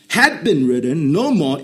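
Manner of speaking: ordinary talk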